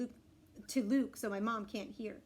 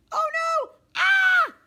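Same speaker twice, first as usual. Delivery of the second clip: high, loud cry of horror; alarmed shriek; 16 dB louder, much slower than usual. Acted. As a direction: shriek in playful panic. Acting child's shock at needle.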